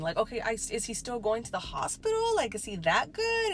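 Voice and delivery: high, rattled what-do-you-mean questions; confused disbelief. Retold or imitated. Narrator retelling her panic.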